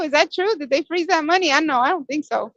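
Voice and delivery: ordinary delivery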